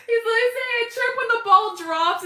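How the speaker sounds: talking through high giggles; can barely get it out for laughing; cracking up while repeating the joke. Real, not acted. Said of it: "It's killing me".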